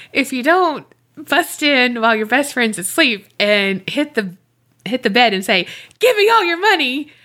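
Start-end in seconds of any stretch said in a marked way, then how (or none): 6.0-7.1 high, playful holdup demand; mock robber. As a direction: pretend to rob a sleeping friend. Acts out mock robbery prank.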